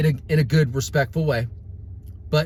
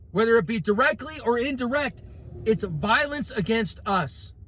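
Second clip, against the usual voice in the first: raised, heated preacher voice; fiery preaching rant; 7 semitones higher than usual. Real, not acted. Ranting preacher fires up his viewers. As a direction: preach it hard to your followers.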